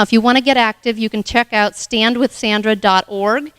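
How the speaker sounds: normal talking voice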